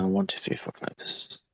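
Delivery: whispered tally, barely voiced; counting under breath. Real, not acted. Musician tallying own albums for interviewer, whispered under breath. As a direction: count softly to yourself in a whisper.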